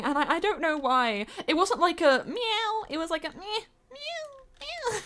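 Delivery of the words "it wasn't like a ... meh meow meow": high squeaky meow; cat meowing. Retold or imitated. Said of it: "I just started meowing".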